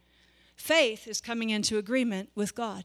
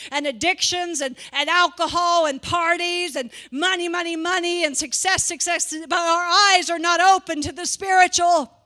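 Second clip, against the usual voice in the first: louder, driving sermon cadence; full-force preaching; 3 semitones higher, 5 dB louder than usual. Acted. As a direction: build the preaching to a climax.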